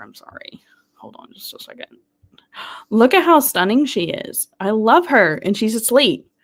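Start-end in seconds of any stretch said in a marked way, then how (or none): 0.0-2.0 breathy whisper under the breath; hushed apology off to the side; whispered aside. Real, not acted. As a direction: murmur it aside while fixing the camera. Fixing the camera mid-stream.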